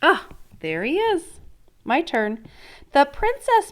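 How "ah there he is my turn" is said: ordinary delivery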